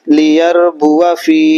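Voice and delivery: লিয়ারবুয়া ফি drawn out very slowly, alif not read; slow teaching recitation of লিয়ারবুয়া ফি; Quranic reading demo. Shown as a demonstration. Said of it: "তাহলে কি হলো উচ্চারণটা?". (ধীরে ধীরে লিয়ারবুয়া ফি উচ্চারণ)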